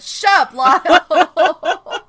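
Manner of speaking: high, loud yell breaking into laughter; exaggerated shriek at a pestering sibling; mock snapping shout. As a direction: snap it loudly, hamming up the irritation. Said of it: "she turned around and went".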